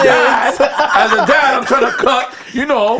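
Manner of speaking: high-pitched, laughing self-defence; laughing protest. Real, not acted. Teased dad explaining himself over friends' laughter, laughing protest. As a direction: push the point through laughter.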